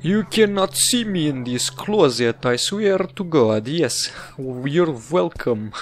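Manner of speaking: hammed-up bad Russian accent. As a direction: do a shaky attempt at a Russian accent. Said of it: "I'm not trying to do the Russian accent again".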